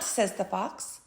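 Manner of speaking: plain reading voice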